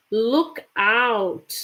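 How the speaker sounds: ordinary delivery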